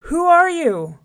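high, very loud challenge; hollered. A narrator's reading. Lion challenges his reflection in the well, hollering. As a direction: holler down at a rival. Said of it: "He hollered".

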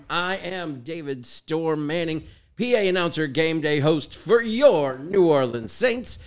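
slow, punched stadium introduction; stretched-out names, big host delivery; show-opening announcer. Acted. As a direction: introduce yourself like an arena PA announcer.

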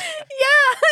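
high squealing laugh. Real, not acted. Laughing at the joke.

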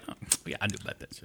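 breathy, unvoiced, fast throwaway line; dismissive whisper. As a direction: whisper it like you always knew. Imitating dismissive people.

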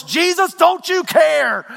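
high, drawn-out cry of reproach; stretched, high-pitched indignant demand; accusing question. Acted. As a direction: challenge them with a pleading shout. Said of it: "They're questioning the compassion".

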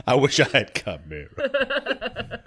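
slow, laughing parody of "I wish I had Chimera"; mocking the line while laughing; laughing mock repeat. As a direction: drag it out through giggles.

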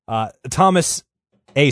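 usual speaking voice

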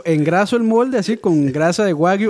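hammed-up wagyu-recipe boast, drawn out; mock bourgeois cook. Mocking fancy budín recipes for co-hosts, hammed-up bourgeois cook. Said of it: "Dice así".

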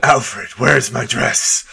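slow, badly faked superhero voice; Batman. Podcaster joking to Batman's butler, bad Batman voice. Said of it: "real bad Batman voice".

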